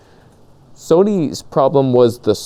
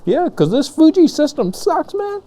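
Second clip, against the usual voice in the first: much higher and eager, gushing; excited. Retold or imitated. Thinking a free camera was coming, excitedly high and eager. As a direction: blurt it out like a kid getting a gift. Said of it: "So I was getting all excited".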